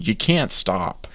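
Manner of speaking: ordinary delivery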